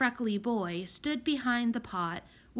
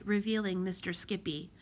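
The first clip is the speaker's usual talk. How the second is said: ordinary delivery, nothing marked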